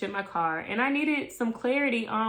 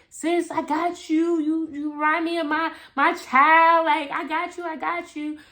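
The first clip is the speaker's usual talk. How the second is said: lightly voiced motherly coworker; older coworker's voice; 7 semitones higher than usual. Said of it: "she was just like".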